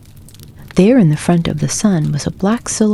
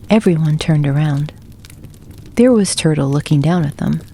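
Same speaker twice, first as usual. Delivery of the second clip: usual speaking voice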